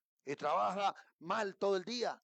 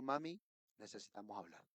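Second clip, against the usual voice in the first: father to daughter; 9 semitones lower, 10 dB quieter than usual. Retold or imitated. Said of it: "O yo le digo".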